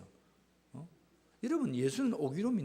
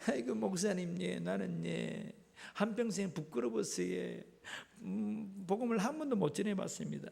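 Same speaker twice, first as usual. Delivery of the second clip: mock sheepish churchgoer; 3 semitones higher, 3 dB quieter than usual. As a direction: play up a bashful confession to the pastor. 부끄러워하는 교인 흉내. (부끄러워하는 교인을 흉내 내는 말투)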